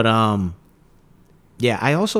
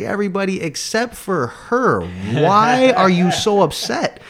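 high, slow, louder teasing call-out; single her out with mock concern; 8 semitones higher, 4 dB louder than usual. To the bored girl in the front row, high slow teasing. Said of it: "I was like".

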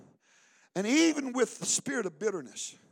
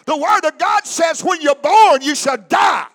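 louder, higher rebuke shout; shouting at the devil; 7 semitones higher, 15 dB louder than usual. Retold or imitated. Preacher retells yelling the devil down by the road. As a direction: scream it back in his ear. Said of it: "I screamed loud in his ear".

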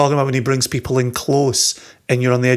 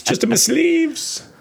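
much higher, slow and drawn out; a higher, sillier sing-song take; high slow silly voice; slower than usual. Pretend. Repeats joke, higher.

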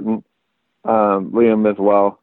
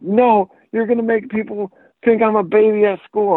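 high-pitched imitation of a kid; protesting young child; 11 semitones higher than usual. Four-year-old refusing diapers, imitated protesting child. Retold quote of young son.

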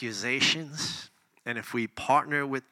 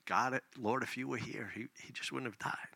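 much softer, breaking into a hushed plea; soft grieving plea; 8 dB quieter than usual. Shown as a demonstration. Preacher voicing Mary's grief to Jesus, soft and hushed. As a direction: pour out grief, not accusation. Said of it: "Same words, but out of a different place of the heart".

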